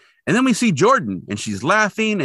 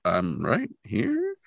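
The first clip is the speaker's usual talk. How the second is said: slow, softer, puzzled mock reply; mock confusion; 6 dB quieter, much slower than usual. Voicing confused Jordan who is right there, slow puzzlement. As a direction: imitate a baffled child, drawing it out. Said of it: "Jordan looks kinda confused".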